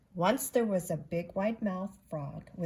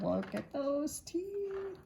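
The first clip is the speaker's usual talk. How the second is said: awed aside; 9 semitones higher, slower than usual. Real, not acted. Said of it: "reading to children".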